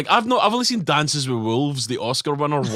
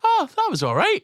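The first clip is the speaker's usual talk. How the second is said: high surprised "Oh, that was all right"; pleasantly surprised. Retold or imitated. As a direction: act mildly impressed. Retold grudging reaction.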